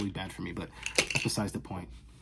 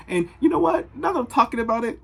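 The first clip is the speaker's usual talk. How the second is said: a squeaky, much higher, louder burst of mock outrage; 9 dB louder than usual. Vlogger springs a playful rant on her viewers, high and loud. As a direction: build up a fake rant.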